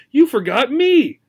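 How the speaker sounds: alarmed call after him; exclaiming. Acted. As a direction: gerbil cries out as the boy passes again. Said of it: "she exclaimed".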